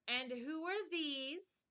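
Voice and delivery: high slow imperious queen. A narrator's reading. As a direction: drag it out like a haughty queen. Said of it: "said the queen".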